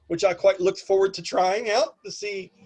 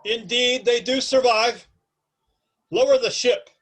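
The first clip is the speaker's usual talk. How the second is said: grandiose captain, raised and commanding; Patrick Stewart; 7 semitones higher, 5 dB louder than usual. Acted. Airship captain orders his crew to descend, grandiose command. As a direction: proclaim it, then give the order. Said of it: "you hear this deep, grandiose voice".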